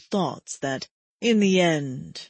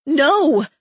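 desperate scream, higher and louder; 7 semitones higher, 9 dB louder than usual. A narrator's reading. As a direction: scream it out in despair. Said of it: "I screamed".

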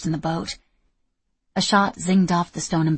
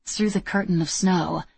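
ordinary delivery, nothing marked

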